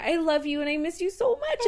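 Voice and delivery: high, loving pet-talk; gushing affection. Retold or imitated. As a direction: re-enact pouring out love to a pet. Retold gushing to dead dog.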